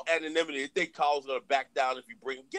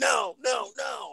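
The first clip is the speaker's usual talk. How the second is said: high, emphatic repeated retort; insistent retort; 7 semitones higher, much slower than usual. Retold or imitated. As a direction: punch out each word insistently. Retells emphatic reply to questioner.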